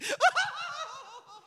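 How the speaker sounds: high, hammed-up sobbing wail; exaggerated fake crying; mock sobbing. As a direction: wail like an overacting mourner. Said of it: "they're sobbing".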